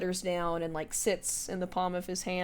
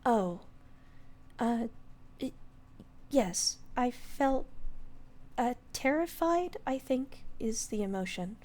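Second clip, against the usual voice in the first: higher, hesitant little fairy voice; timid fairy; 5 semitones higher, 3 dB quieter than usual. Acted. Coriander admitting fear to the lord, higher and timid. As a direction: stammer like a small shy fairy.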